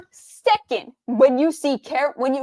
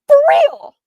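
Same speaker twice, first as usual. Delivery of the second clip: loud, high disbelieving yell; a sudden high cry of "come on"; exasperated shout; 10 dB louder than usual. Real, not acted. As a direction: burst out in disbelief. Exasperated outburst at game character.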